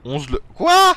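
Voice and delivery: high, loud outraged surprise; shocked disbelief. Real, not acted. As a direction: blurt it out in disbelief. Told they need eleven levers.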